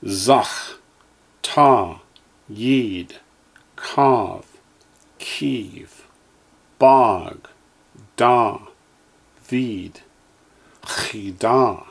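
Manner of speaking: each syllable said slowly and clearly, with a pause after it; teacher's careful drill voice; Hebrew syllables for echoing. Shown as a demonstration. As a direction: model every Hebrew sound for the class to repeat. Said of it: "Line six".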